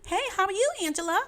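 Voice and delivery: high, mock-chirpy greeting; exaggerated high mimicry of a cheery hello; son mimicking Mom. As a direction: tease Mom by parroting her happy voice. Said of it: "that's how you sound".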